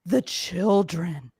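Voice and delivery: slow, put-on reverence; mock solemnity. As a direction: draw it out with mocking gravity. Mocks invoking the children.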